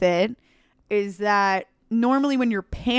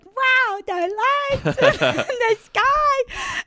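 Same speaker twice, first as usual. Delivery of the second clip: much higher, very slow, dreamy awe; dazed wonder; 14 semitones higher, much slower than usual. Retold or imitated. Friend having a good trip gazing skyward, high dreamy awe. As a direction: float it like a blissed-out tripper.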